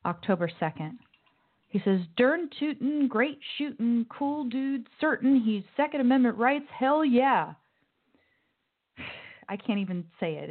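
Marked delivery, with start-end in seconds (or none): 2.2-7.5 hammed-up Southern drawl, pitched higher; mock Southern accent. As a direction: read the tweet like a mocking Southern gun fan. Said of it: "supposed to be in some Southern accent".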